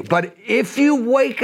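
slow, lifted plea to wake up; high pleading call. Retold or imitated. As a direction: urge them gently but high. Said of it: "He goes".

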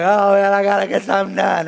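hammed-up, loud complaint on a held note; mimicked guilty wife. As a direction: imitate a wife wailing with guilt.

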